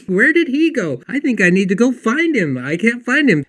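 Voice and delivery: high, loud, lively mock bewilderment; animated retelling. [name] explaining why she went looking for Troy, animated. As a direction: play it up as a puzzled, lively colleague.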